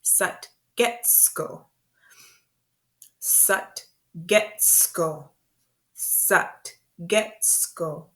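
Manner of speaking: "Satgetsko" very slowly, syllable by syllable; slow demonstration. Shown as a demonstration. Teacher introducing a new word to beginners, very slow and segmented. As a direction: break "Satgetsko" into drawn-out, separated syllables. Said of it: "So this word is Satgetsko".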